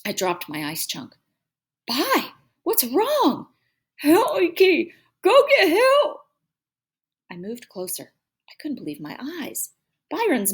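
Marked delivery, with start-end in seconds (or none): 1.9-3.5 high, slow, drawn-out worried question; alarmed. A narrator's reading. Alarmed call to crying brother. 4.0-6.2 high, loud garbled pleading; mouth stuck. A narrator's reading. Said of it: "like he had something in his mouth".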